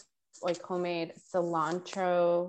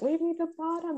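put-on high child plea; 11 semitones higher than usual. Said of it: "my mom cooking rice is, like".

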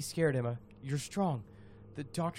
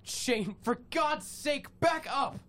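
urgent warning; 8 semitones higher, slower than usual. Acted. Warning friend in danger.

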